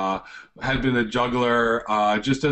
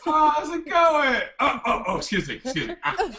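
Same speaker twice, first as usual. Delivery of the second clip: high squeaky comic greeting; squeaky silly voice; 13 semitones higher than usual. Acted. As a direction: pitch way up, cartoonish and giggly.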